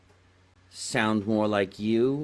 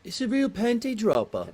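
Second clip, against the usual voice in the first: a much higher, lightly put-on English voice; mock English accent; 11 semitones higher than usual. Repeating husband's line in a mock English accent. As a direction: toss off the line in a playful posh English accent. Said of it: "That, exactly right".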